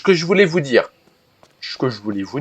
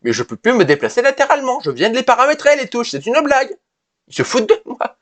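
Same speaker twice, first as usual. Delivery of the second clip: much higher, indignant rant; real gaming rage; 10 semitones higher than usual. Real, not acted. Gamer ranting to viewers about broken keys, high indignant rage. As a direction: rage that the keys he just set are broken. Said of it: "je commence déjà à rager".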